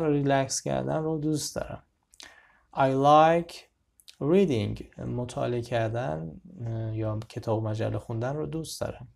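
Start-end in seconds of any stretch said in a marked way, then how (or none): none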